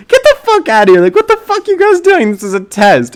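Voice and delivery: high, loud mock teacher outburst; angry teacher. Teacher throwing out two students who interrupted a test, imitated angry high yell. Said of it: "his teacher is just like".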